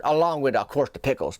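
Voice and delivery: ordinary talk, nothing marked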